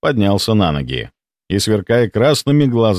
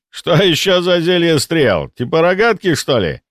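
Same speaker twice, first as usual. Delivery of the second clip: smirking; 7 semitones higher than usual. A narrator's reading. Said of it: "усмехнулся он".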